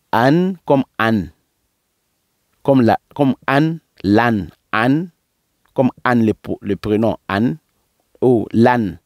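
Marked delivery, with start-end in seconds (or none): none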